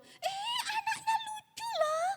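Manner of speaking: very high mock squeal; delighted husband, imitated. Husband marvelling at newborn to wife, hammed-up squeal. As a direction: squeal it in cartoonish amazement. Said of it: "saya itu terkejut. Suami saya itu bilang".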